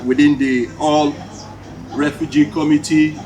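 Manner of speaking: ordinary talk, nothing marked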